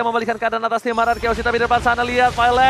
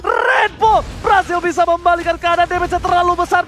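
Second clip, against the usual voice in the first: fighting game announcer; 7 semitones higher than usual. Acted. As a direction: call the teamfight at full hype. Esports caster hype call.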